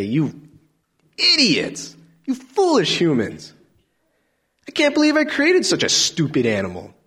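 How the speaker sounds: high, loud contemptuous rant; mock scornful God. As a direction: sneer at humanity like a disgusted creator. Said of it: "that's not God".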